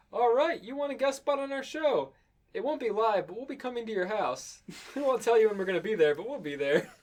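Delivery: perky host voice. Acted. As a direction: pitch it as a cheery host. Imagined show hosts voiced.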